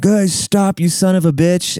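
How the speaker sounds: imitating kids yelling at fleeing enemies; retold chase yell. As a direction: holler after someone fleeing, kept contained. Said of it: "we're running behind them like".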